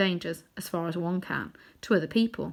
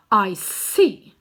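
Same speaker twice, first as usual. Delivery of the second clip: loud scornful dismissal; 8 dB louder than usual. A narrator's reading. Queen scorning Uncle Andrew, loud and contemptuous. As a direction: look down on him. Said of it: "she said scornfully".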